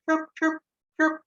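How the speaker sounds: very high squeaky chirp chirp chirp; cricket chirps. Shown as a demonstration. Teacher shows kids the cricket sound, high squeaky chirps. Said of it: "Kind of a chirpy sound".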